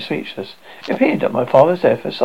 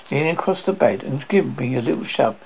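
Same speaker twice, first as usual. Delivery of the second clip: plain reading voice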